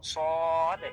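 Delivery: সদ long and full with a rounded o; loud, thick, stretched সদ; thick, heavy letter. Shown as a demonstration. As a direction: make সদ heavy and drawn out. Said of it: "এই হরফটা হলো মোটা করে পড়ার".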